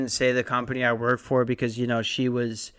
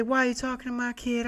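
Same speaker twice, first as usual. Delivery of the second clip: [name] voice; 11 semitones higher, 3 dB quieter, faster than usual. Retold or imitated. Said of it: "went full [name]".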